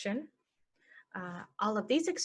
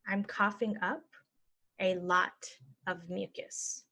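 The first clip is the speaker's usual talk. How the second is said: slow, broken into chunks; slow chunked. Shown as a demonstration. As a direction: model it slowly for learners. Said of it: "normal then slow".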